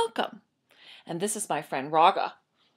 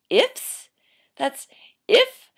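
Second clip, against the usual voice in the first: ifs and if popped high and loud; stress on ifs; 11 semitones higher, 9 dB louder, much slower than usual. Acted. Baker correcting the town, hard stress on "if". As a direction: punch each "if" on its own.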